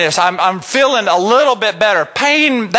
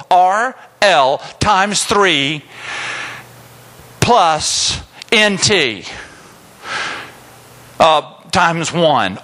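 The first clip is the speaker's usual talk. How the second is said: slow spelled formula; 4 semitones lower, much slower than usual. Shown as a demonstration.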